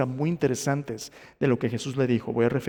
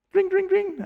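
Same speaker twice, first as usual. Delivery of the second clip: very high-pitched imitated bell; sing-ring it high like a small bell; little bell ringing; 3 dB louder, slower than usual. Preacher prompts listeners' memory, high ringing bell imitation. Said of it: "¿Hay una campanita ahí en tu mente?".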